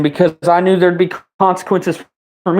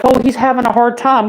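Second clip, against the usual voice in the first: mocking girlfriend; 8 semitones higher than usual. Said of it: "She's like".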